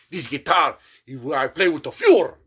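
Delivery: loud, lightly voiced old German seller; a hint of an ancient German man; mock old German. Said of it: "He's like Hitler's brother".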